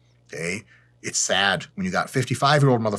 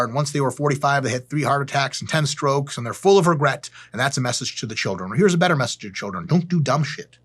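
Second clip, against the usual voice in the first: ordinary talk, nothing marked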